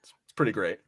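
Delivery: whispered aside; soft unvoiced remark. Real, not acted. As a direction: breathe it out with no voice.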